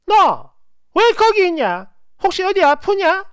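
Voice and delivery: loud, high mother-in-law demanding answers; picking a fight. A narrator's reading. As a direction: pounce with pushy, high-pitched questions. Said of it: "말투가 너무 십이 조예요".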